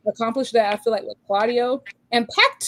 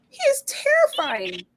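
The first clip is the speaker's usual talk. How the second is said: mock horror. Hyping Claudio as demon.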